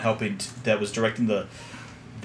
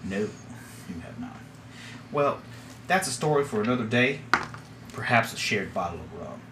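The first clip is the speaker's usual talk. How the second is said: quiet and knowing; 8 dB quieter than usual. Acted. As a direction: let it drop with a hint of menace. Captain deflects softly.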